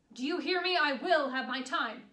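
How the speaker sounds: loud, furious demand; furious shout. Acted. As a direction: shout it at the guard, every word forceful. Escalated repeat of demand at guard.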